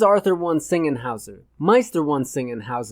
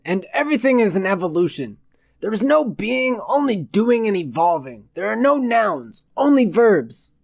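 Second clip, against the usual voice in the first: higher-pitched grandiose declaiming; pompous self-proclaimed philosopher; 5 semitones higher than usual. A narrator's reading. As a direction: proclaim it like a self-important sage.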